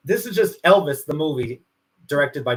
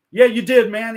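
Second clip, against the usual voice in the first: higher, louder acted retort; insist, imitating an irked star; 6 semitones higher, 6 dB louder than usual. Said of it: "[name] is annoyed".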